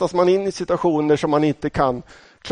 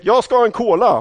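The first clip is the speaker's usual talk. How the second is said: high, loud call over the bar noise; pushy shouted cola order; shouting an order; 7 semitones higher, 4 dB louder than usual. Retold or imitated. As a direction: yell the order above the crowd. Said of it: "trängde mig in och skrek".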